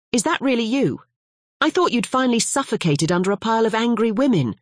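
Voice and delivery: high, joking ribbing; teasing professor friend. Acted. Albero jokingly ribs Winslow about his love life, high and playful. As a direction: needle him with a grin.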